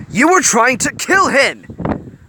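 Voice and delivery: slow, loud, high shouted accusation; angry accusation. A narrator's reading. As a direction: hurl the charge at him slowly. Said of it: "Fred replied angrily".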